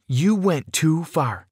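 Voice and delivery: high, slow accusation; drawn-out, emphatic reproach; angry retort. A narrator's reading. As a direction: hammer out each word in hurt anger. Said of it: "I retorted".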